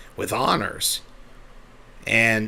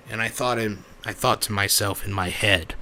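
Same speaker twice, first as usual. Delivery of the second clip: usual speaking voice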